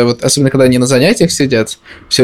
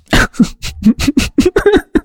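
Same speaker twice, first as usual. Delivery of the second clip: high laugh; 13 semitones higher than usual. Real, not acted. Laughs at own joke.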